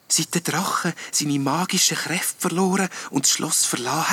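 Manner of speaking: soft, high, wispy ghost voice; earnest little ghost. A narrator's reading. Little ghost telling of the dragon legend, earnestly. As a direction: explain the legend in a hushed high voice.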